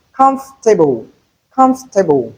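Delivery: comfortable as KUMF-te-bul; three-syllable comfortable. Shown as a demonstration. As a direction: say comfortable without the "or". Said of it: "we have three".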